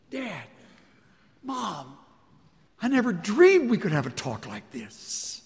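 put-on grown child moved. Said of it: "They had the courage to come".

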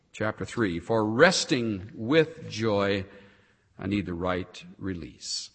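ordinary talk

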